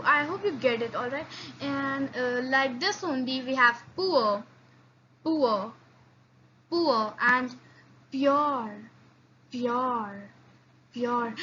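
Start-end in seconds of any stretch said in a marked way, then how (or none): 3.4-7.5 poor with a firm hard p. Shown as a demonstration. Teacher demonstrates hard p. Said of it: "understand the difference". 8.1-11.3 pure with a soft palatal p. Shown as a demonstration. Teacher demonstrates soft p. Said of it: "understand the difference".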